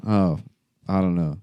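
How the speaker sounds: usual speaking voice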